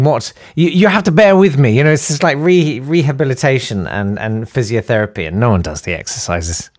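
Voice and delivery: ordinary talk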